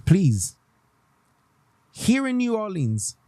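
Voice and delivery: ordinary talk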